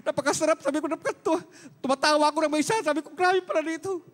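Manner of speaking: giddy, high-pitched delight; overjoyed. Retold or imitated. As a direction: burst out with the joy of being healed. Retelling joyful healing, laughing.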